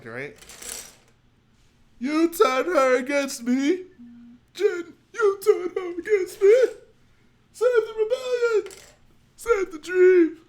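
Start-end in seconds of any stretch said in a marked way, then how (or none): 2.0-10.4 high, loud, sobbing shouted lament; Saw Gerrera impression. Saw Gerrera pleading to Jin, sobbing shouted lament. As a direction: wail it like a broken rebel leader. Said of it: "that sounds like a Saw Gerrera line".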